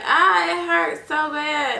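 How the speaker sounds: retold scream. Mother on the operating table crying out in pain. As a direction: drag it out like reliving the pain. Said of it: "I was screaming on the table".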